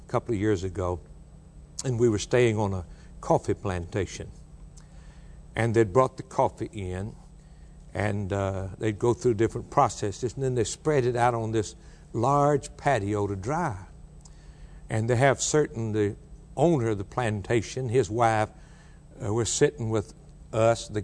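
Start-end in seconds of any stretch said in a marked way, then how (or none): none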